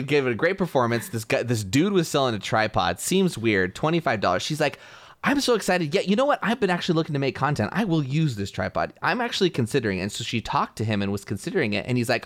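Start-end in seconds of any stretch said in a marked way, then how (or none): 5.2-10.0 higher, eager gushing as the buyer; retold excitement. Theater performer high on her great show, retold excitement. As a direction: talk yourself into it, pitched up.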